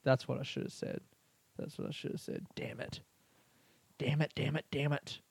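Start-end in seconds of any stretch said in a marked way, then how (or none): 1.6-2.5 whispered to himself in regret; repeating it to himself in a whisper. Real, not acted. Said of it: "repeats it, drops to a whisper". 2.5-5.2 muttered self-reproach. Real, not acted. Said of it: "muttering to himself".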